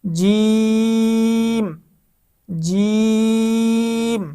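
জিম with a firm j and long held ee; firm articulation. Shown as a demonstration. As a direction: press the j of জিম, then stretch the vowel evenly. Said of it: "একটু শক্ত উচ্চারণ হবে". (শক্ত উচ্চারণে জিম টেনে)

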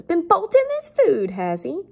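loud, high mock accusation; Missus Joe accusing. Teacher voices the sister accusing Pip, loud and high. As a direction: pitch jumps up, then drops on the tag question. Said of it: "and Missus Joe says".

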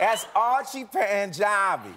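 slow, amused New Orleans drawl; New Orleans accent. Shown as a demonstration. Host models the name, New Orleans accent. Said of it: "like, a New Orleans accent".

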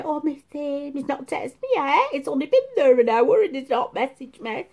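high, whiny mock lament; mock moaning.

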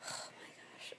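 breathy whispered aside with a laugh; tired whispered confession. Real, not acted. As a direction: drop to a whisper, half laughing.

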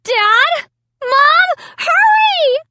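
high, loud screams for help; angry screams. A narrator's reading. Sister calling parents down the hall, angry screaming. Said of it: "Sarah's angry screams down the hall".

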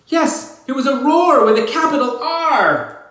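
high, loud and excited; proud of the big roar; thrilled. Acted. Narrator thrilled about her roar, high and loud.

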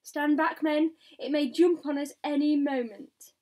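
yelled warning. A narrator's reading. Police chief warning his men to back away, urgent yell. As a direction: bellow at them to keep clear. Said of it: "yelled the chief of police".

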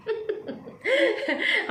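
high, mocking burst of laughter; derisive laugh. Real, not acted. Ranting at a rival called psycho, derisive laugh.

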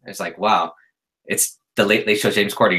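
usual speaking voice

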